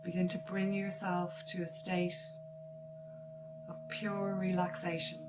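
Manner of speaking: slow meditation voice with long pauses; guided meditation. Acted. Artist leads listeners into noticing their breath, guided meditation. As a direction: guide them gently into relaxation, phrase by phrase.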